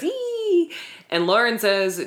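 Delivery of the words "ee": high-pitched mock squeal; feigned excited squeal. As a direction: imitate a fake-excited little "eee". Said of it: "Lo again feigns excitement and says".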